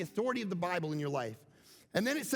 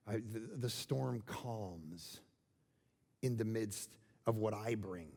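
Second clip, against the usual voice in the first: Jesus calming frightened followers, tender and hushed; 10 semitones lower, 4 dB quieter than usual. Acted. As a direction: speak it like a calming hand. Voicing Jesus bringing peace.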